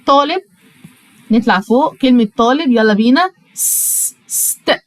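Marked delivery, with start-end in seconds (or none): 3.5-4.8 س- and ست breathed without voice, sound by sound; whispered sounding out. Shown as a demonstration. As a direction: sound out the start of student in a whisper. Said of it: "هنطق حرف اليو باسمه". (تهجي همس للحروف)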